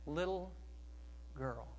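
put-on soft, tender address to a child; slow and hushed, lovingly; very quiet, gentle. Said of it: "I think he said it very quiet".

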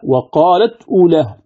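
"وقالت أولاهم" as wa-qaalat, 'uulaahum; original form with hamza. Shown as a demonstration. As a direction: stop on t, then a glottal stop into "أولاهم". Verse quoted before transfer is applied.

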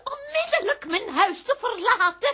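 hoge, luide spookstem die beveelt; spookachtige heks. Acted. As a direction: jaag ze als een spook het huis uit. Spook beveelt indringers weg. (hoge, dreigende spookstem)

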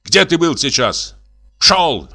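high-pitched curt master; furious scolding. A narrator's reading. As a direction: berate the servant and send him off. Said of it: "набросился на него хозяин". (сердитый окрик хозяина)

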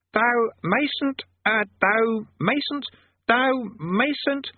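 high, halting, stuck on mayn't; fumbling archaic command. Acted. Conjurer failing to phrase a command, fumbling high. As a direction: trip over the old-fashioned word. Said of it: "the conjurer of demons gave up".

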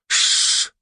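loud hiss to hush; shushing. Acted. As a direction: hush with a sharp shh. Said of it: "прикладывает палец к губам".

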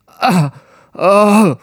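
sharp strangled gasp for air; choking gasp. Acted. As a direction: gasp like you can't breathe. Man suddenly cannot breathe. (задыхающийся вскрик)

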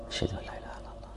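the same line in a soft whisper; whispered repeat. Shown as a demonstration. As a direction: drop to a whisper, as if only those nearby should hear. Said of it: "بصوت منخفض". (همس خافت بالشهادة)

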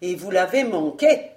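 loud, rising furious rebuke; angry lord berating failed henchmen; mounting anger. A narrator's reading. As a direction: build the anger to a peak. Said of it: "dont la colère allait croissant".

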